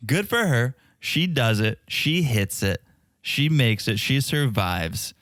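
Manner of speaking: ordinary delivery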